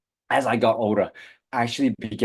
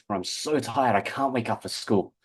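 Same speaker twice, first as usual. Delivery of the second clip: usual speaking voice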